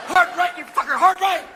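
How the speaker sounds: high, loud mock panic yell; urgent hammed-up shouting; yelled orders. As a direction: shout steering commands at your partner. Acted luge rider yelling steering orders.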